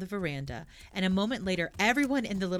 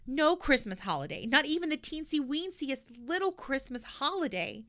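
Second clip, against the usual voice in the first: higher, whiny complaint; complaining; 7 semitones higher than usual. A narrator's reading. Pippi complains to friends about no holiday, whiny. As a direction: moan about missing out. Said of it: "she complained".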